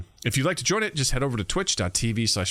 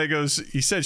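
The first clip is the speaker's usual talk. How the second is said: ordinary talk, nothing marked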